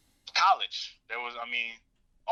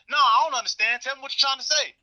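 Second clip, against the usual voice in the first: mock challenge pitched high and pushy; retold defiant retort; 10 semitones higher, 4 dB louder than usual. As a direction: goad him to spell it out. Dad pushing back in argument.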